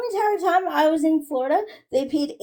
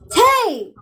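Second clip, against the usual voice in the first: raised, higher-pitched protest; real argumentative yelling, pitched up; 5 semitones higher than usual. Real, not acted. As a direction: call out sharply in a heated argument. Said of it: "Why the fuck are you yelling?".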